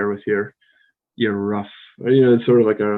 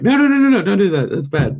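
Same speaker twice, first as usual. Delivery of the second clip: high, loud mock "No, no, no!" from a teacher; mock scolding; 11 semitones higher, 5 dB louder than usual. Imagined Ruben scolding her bad tip, in a high, loud mock voice. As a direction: play a horrified teacher stopping bad advice. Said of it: "Ruben will hear this and be like".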